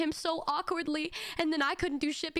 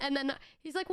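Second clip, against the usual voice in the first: usual speaking voice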